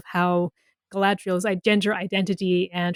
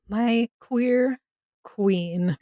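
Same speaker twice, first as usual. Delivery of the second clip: slow, savored "my queer queen"; drawn-out savoring; 2 semitones higher, much slower than usual. Acted. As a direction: stretch out "queer" lovingly. Affectionate echo of a phrase.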